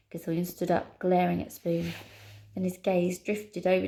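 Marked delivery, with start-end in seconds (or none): none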